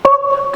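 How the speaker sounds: very high chirped beep like a navigator reroute; high GPS beep. Retold or imitated. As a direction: make a little high electronic ping. GPS sound effect.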